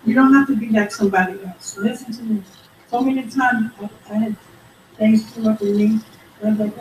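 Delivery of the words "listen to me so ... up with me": normal talking voice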